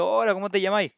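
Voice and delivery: a lightly voiced tourist repeating a strange phrase; imitating a puzzled visitor. Teacher acts out a foreigner arriving in Chile, lightly voiced. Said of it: "vas allá y dices".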